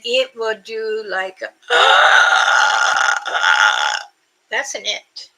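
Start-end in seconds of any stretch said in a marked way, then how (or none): 1.5-4.1 a sharp, loud gasping call; mimicked creature gasp. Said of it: "I will try to mimic what they do".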